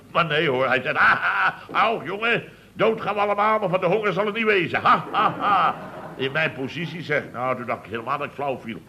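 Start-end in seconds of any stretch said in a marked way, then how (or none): 1.0-5.7 laughing. Retold or imitated. Raadslid Toon laughs at him.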